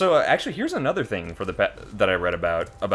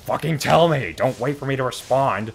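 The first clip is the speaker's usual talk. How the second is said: higher, louder mock exasperation; exasperated; 5 semitones higher, 4 dB louder than usual. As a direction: snap back as if fed up. Exasperated reply to the pet peeve.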